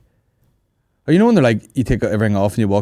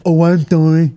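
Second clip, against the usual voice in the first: loud, higher mock apology in a put-on film-star voice; Marlon Brando voice; 7 semitones higher, 6 dB louder, slower than usual. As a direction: ham up a sorry like a famous actor. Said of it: "it's my Brando impression".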